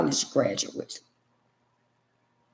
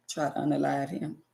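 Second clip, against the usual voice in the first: normal talking voice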